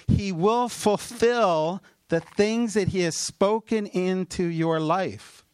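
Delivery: slow, lifted, ringing preacher proclamation; prophetic declaration. Real, not acted. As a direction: proclaim it over the room like a promise. Preacher declaring prophecy over congregation.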